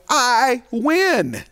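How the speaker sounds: high, triumphant call of victory; a hammed-up shout of triumph; loud voice. A narrator's reading. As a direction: cry out that you've won. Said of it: "he says with a loud voice".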